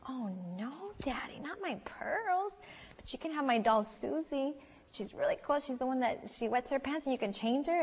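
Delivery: put-on high child's voice, pleading then chattering; high little-girl voice. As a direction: plead like a small daughter, then sell the substitute. Daughter pleads, offers doll in story.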